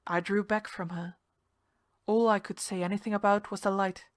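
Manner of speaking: plain reading voice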